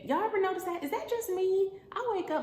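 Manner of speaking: excited rant. Real, not acted. To the audience about bonnets, exasperated high rant. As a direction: crank it up, baffled at the bonnet.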